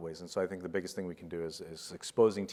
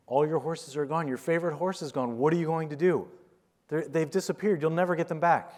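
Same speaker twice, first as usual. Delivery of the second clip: high, loud alarmed villagers; panicked townsfolk; 9 semitones higher, 6 dB louder than usual. Retold or imitated. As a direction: play the frantic neighbours crying doom. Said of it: "the people come up ... they're like".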